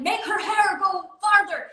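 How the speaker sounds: a high, pushed call of a director's order; mock yell. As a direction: shout it across the water, mildly. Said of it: "he kept yelling".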